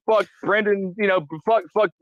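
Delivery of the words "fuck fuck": ordinary talk, nothing marked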